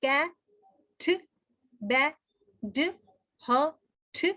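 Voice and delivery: isolated syllables said one by one; clipped single syllables, one at a time; syllable sounds. Shown as a demonstration. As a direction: sound out each syllable separately with a pause. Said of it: "syllable का जरिया भी बनते हैं".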